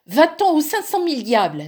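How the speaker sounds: high, loud furious cursing; cry out. A narrator's reading. Both sisters shrieking curses at the Frost. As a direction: hurl the curse together. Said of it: "s'écrièrent-elles".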